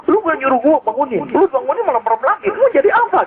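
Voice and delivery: a sulking angel scolds, pitch climbing high at the end; mock-grumpy angel complaining he wasn't asked to wake you; sulky angel scolding. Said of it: "Ngambek malaikatnya".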